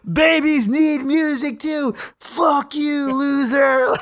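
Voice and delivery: high, loud mocking heckler voice; jeering crowd. Crowd mocking the dad who unplugged the band, loud high jeering. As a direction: jeer at him like a drunk partygoer.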